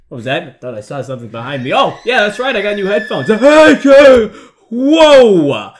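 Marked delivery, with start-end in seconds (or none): 3.3-5.7 high, loud jump-scare yell; startled shout. Real, not acted. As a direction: holler out in alarm.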